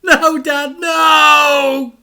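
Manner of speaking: high, loud anguished cry; a son shouting as his dad's car crashes; desperate shout. A narrator's reading. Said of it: "shouted Frank from the top".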